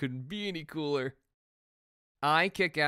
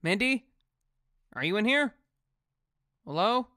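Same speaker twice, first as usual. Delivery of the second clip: calling out; 3 semitones higher, 5 dB louder than usual. Acted. Calling out in the hideout.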